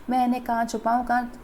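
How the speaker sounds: ordinary talk, nothing marked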